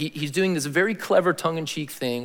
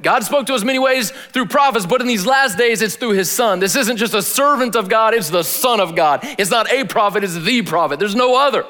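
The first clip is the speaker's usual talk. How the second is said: loud, high, ringing retelling of the burning bush; preaching at full force; 6 semitones higher, 5 dB louder than usual. Acted. Preacher retelling the burning bush to his congregation, loud and high. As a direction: proclaim it to the back row.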